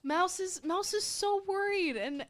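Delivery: high, loud, fretting for the character; worried. Acted. Player voices worry for her character.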